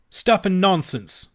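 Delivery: loud, slow, indignant outburst; angry retort. A narrator's reading. Toad furious at the ferret, loud slow outburst. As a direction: spit it out in a temper. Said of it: "said Toad very angrily".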